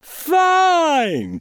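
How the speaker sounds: a high bright cheer from the cat; a cat cheering the duck on. Acted. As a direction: cheer him on, delighted. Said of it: "cheered the cat".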